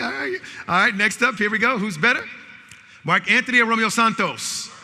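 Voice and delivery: loud, high call-and-response hype; game announcer. Real, not acted. Host calling out music match-ups to the audience, loud announcer hype. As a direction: fire up the crowd to shout back.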